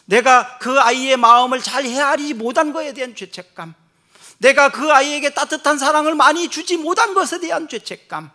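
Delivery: fervent sermon climax; impassioned preaching. Acted. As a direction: pour out the father's guilt. Preaching a father's guilt.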